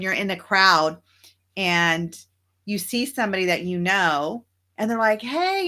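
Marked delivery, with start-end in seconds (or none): 5.2-5.7 high bright hey to a friend; cheery greeting. Retold or imitated. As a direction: call out a happy hey.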